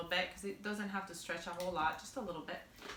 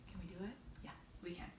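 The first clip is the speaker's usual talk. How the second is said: whispered pep talk; 2 semitones lower, 17 dB quieter, much faster than usual. Real, not acted. Whispered self-encouragement.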